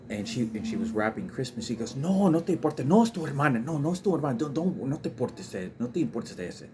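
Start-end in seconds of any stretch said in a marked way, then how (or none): 1.9-6.7 loud, high, heated Spanish scolding; frustrated angry. Retold or imitated. Mother scolding her son in Spanish, frustrated and loud. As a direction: snap at the child in rising anger, then ease down. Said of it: "my mother very frustrated and very angry".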